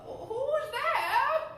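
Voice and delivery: put-on high, quavering old granny voice; a high, thin granny calling out; old grandmother. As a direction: answer the door as a frail grandmother. Wolf knocks, grandmother answers.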